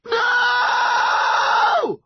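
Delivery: long, high wail; anguished cry. A narrator's reading. Son losing hold of his mum, crying out. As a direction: draw the word out into a scream. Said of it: "he cried".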